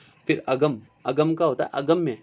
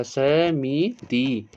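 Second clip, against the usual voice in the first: slow careful demo; much slower than usual. Shown as a demonstration. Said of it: "समिति भी ऐसे ही".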